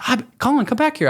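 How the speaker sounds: high-pitched imitation of a woman calling; calling someone back. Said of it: "she calls me back".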